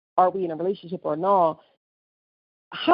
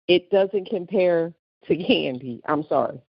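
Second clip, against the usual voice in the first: ordinary talk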